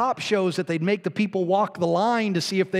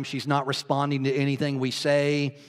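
ordinary delivery

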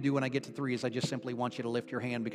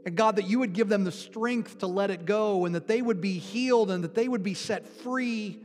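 raised, impassioned preaching prayer, higher pitched; fervent prayer; 7 semitones higher than usual. Real, not acted. Pastor praying aloud over his hurting congregation, impassioned and raised. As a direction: pray over them with full fervour, voice lifted.

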